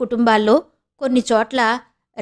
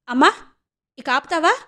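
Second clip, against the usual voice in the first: higher, pushed protest to her mother; said sharply; 5 semitones higher than usual. A narrator's reading. Daughter cutting off her mother's insinuations, sharp higher protest. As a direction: snap at her mother to stop, pitched higher. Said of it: "అంది గట్టిగా".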